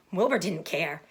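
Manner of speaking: plain reading voice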